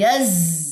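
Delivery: یز with a buzzing, held ز sound; slow demo of یز with a drawn-out ز. Shown as a demonstration. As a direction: model the ز sound of یز for the students. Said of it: "یہ آواز آئے گی".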